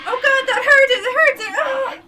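high, loud screams of pain; agonized screaming. Acted. Mike screaming as the crate creature bites, loud and high. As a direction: shriek as if the monster has your arm. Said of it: "Mike's screams are cut short".

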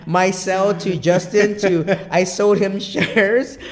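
words squeezed out through loud laughter, slow; laughing. Real, not acted. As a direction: laugh hard while getting the words out. Laughing while telling the deal.

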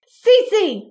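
high, loud roar of a name; a furious mother's roar. Acted. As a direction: bellow her daughter's name to stop her. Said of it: "Mama roared".

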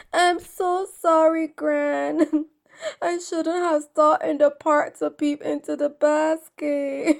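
put-on high, loud, sobbing little girl; hammed-up crying. Red apologizes to her Gran in tears, acted high crying. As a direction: wail the apology through acted sobs. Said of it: "she cried as big tears".

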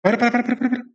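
high panicked para; urgent yelp to halt; frantic stop. Real, not acted.

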